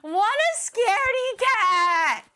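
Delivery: slow, loud, high mocking drawl; mocking taunt. Real, not acted. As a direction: tease him for being scared. Mocking a scared friend.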